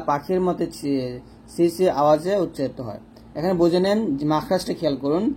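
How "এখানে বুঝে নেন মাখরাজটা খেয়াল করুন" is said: usual speaking voice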